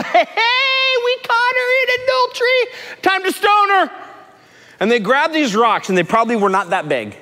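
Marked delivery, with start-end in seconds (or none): 0.0-3.9 high put-on accusing crowd voice; mock mob voice. Townsmen bringing the woman to Jesus, high mock voice. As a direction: play the eager stoning mob. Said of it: "they go".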